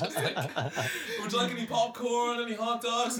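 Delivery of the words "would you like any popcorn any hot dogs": mock cinema staff voice. Mocking cinema staff offer.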